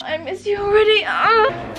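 hammed-up fake sob, high; mock crying. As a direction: pretend wail of missing her. Mock goodbye.

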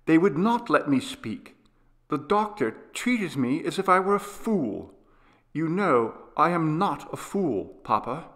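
put-on high, protesting child; indignant sick boy. Boy protests to his father.

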